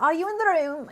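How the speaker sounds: British accent, playful. Shown as a demonstration. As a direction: ask it like a British medium. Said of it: "that was British".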